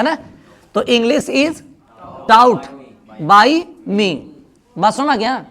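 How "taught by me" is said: usual speaking voice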